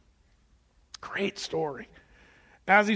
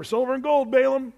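raised, higher call offering gold; eager calling out; 6 semitones higher, 5 dB louder than usual. Retold or imitated. Messengers tempting Balaam with gold, calling out loudly.

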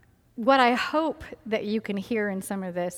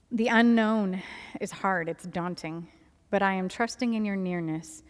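reading a journal prayer. Acted. Reads her journal prayers aloud.

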